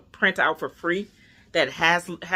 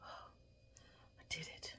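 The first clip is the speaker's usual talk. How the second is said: barely audible, drawn-out whisper; stunned whisper; 28 dB quieter, much slower than usual. Real, not acted. To viewers, just after the injection, in a stunned whisper.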